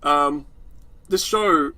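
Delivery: ordinary talk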